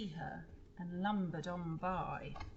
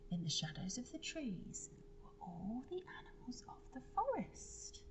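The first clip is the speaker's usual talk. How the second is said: softer whispered reveal; soft, higher whisper of discovery; hushed reveal; 4 semitones higher, 6 dB quieter than usual. A narrator's reading. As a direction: whisper it like a hidden secret. Hiding animals revealed.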